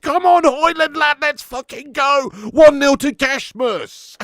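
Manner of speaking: screaming with joy as the ball hits the net; real goal celebration yell; ecstatic goal shout. Real, not acted.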